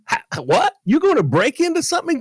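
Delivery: high, stammering "what?"; can't believe what he just heard; mock incredulous retort. Incredulous at the thief.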